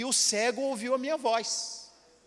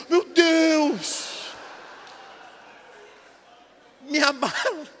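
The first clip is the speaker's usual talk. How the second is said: alarmed exclamation; 4 semitones higher, much slower than usual. Retold or imitated. Preacher realizing his suitcase is gone, alarmed cry. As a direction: gasp it out in alarm.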